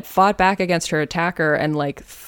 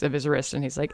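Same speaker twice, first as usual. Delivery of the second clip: ordinary delivery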